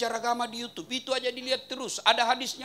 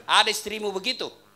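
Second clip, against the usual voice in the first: ordinary delivery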